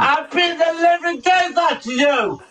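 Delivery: high, shouted threat; angry threat. Real, not acted. Threatening a heckler with a beating, angry shouting. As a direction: shout it like you mean to fight.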